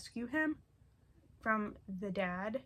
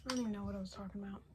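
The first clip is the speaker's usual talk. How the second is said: out of breath; 7 dB quieter, much faster than usual. Real, not acted. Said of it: "huffing and puffing".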